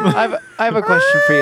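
very high teasing voice; squeaky high voice. Pretend. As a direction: pitch it way up to tease. Teasing Ian, laughing.

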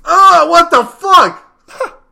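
high, loud, drawn-out mock outrage; startled outburst. Man acting out walking into a wall, loud indignant cry. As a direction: cry out slowly in exaggerated shock. Acting out being shoulder-bumped.

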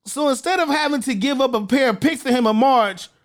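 ordinary talk, nothing marked

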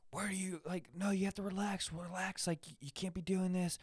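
high, soft imitation of a fussing nurse; mock nurse voice. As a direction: pitch up and hush it like nurses calming a patient. Nurses calming thrashing teen patient.

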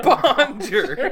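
laughing mock. Laughing mock of bad French.

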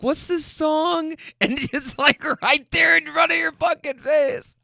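high-pitched, incredulous mimicry; mocking exasperation. Ridiculing her for Shazamming a named song, high exasperation. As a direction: pitch it way up in mock disbelief.

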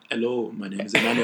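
put-on African accent, projected. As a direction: introduce yourself in a joking African accent. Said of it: "African accent".

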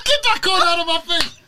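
high, loud film-quote outburst; mock angry shout. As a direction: yell it as a hammed-up comic line. Shouting a favourite film line.

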